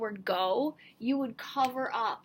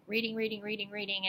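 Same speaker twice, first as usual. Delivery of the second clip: mock speed reading; 2 semitones lower, 4 dB quieter, faster than usual. Shown as a demonstration. Teacher mimicking students who race through text, mock speed reading. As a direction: run the repeated word together, tossed off quietly. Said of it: "just be speed readers".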